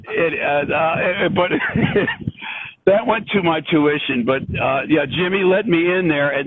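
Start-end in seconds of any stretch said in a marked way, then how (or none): 1.6-2.8 high, breathy laugh. Real, not acted. Laughs about the tuition.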